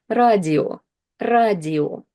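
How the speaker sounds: radio said "RAH-dee-o", ending on a full O; clear final O. Shown as a demonstration. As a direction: pronounce radio the Russian way with an unreduced O. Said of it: "I am clearly pronouncing it as O".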